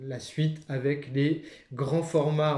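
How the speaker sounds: ordinary delivery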